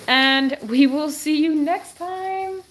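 high, drawn-out goodbye; sing-song farewell. Real, not acted. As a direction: sign off slowly with long, high final vowels. Sing-song sign-off to viewers.

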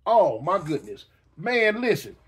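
usual speaking voice

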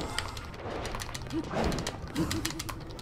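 airy wheezing laugh; breathless laugh. Real, not acted. Gamer laughing at a wild play, breathless.

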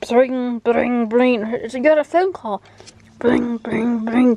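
loud playful "bring, bring" ringing; phone ring. Pretend. Owner pretending the cat's toy phone rings, loud playful ringing.

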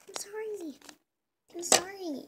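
ordinary delivery

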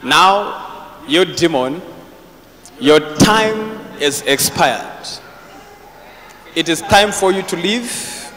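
slow, solemn rebuke; stern command. Real, not acted. Prophet rebuking the demon in the woman, slow stern command. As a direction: order the demon out with authority.

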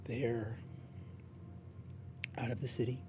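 game master narrating an empty home softly, wistful; hushed story narration. A narrator's reading. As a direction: let the empty house fall silent. GM's quiet closing narration.

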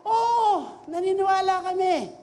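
shouted chant of belief; crowd shouting. Retold or imitated. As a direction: yell it out like an excited crowd. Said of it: "sumisigaw na".

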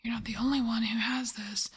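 normal talking voice

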